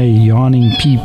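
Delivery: ordinary delivery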